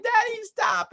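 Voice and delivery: put-on high little-kid voice; imitating laughing kid. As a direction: squeak it like a giggling toddler. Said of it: "He's like".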